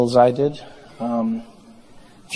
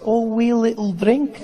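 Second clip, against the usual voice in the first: put-on high, lilting Scottish accent rising on drink; harmless-sounding Scottish; 7 semitones higher than usual. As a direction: make it tiny and innocent in a Scottish accent. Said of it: "It makes it sound harmless".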